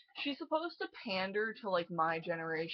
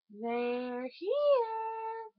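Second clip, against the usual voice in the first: put-on high, eerie child voice, stretched out; creepy little girl whisper-call; 14 semitones higher than usual. Shown as a demonstration. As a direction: say it like the haunted girl from the original. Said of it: "She didn't say it like that".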